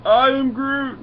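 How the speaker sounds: hammed-up monster roar of a self-introduction; Groot. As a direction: belt it out as the tree creature. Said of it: "you know how cool Groot is".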